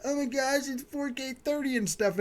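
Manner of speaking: high, breathless mock awe, mimicking a dazzled fan; sarcastic imitation of starstruck gamers raving about 4K; mock gushing fanboy. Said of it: "Everybody's getting all googly-eyed over, like".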